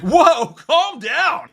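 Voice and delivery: loud, high, drawn-out mock alarm; mock outburst. Reviewer mimicking a clumsy movie line, mock outburst. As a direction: imitate a bad actress overdoing it, loud and slow.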